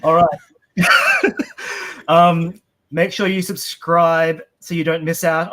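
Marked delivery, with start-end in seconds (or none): 0.8-2.0 very high-pitched burst of laughter; real giggle. Real, not acted. Host still amused while wrapping up, high laugh. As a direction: let out another high giggle.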